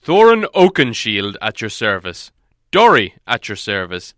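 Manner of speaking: loud, formal dwarf greeting; bowing dwarves. Acted. Dwarves bowing to Beorn, loud and formal.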